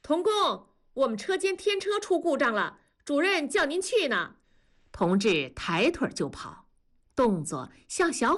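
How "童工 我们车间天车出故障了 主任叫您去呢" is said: high-pitched call across a distance; high, carrying voice of a worker running in with news; calling from afar. A narrator's reading. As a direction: hail him urgently from far off. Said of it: "老远就喊".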